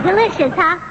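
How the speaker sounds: ordinary talk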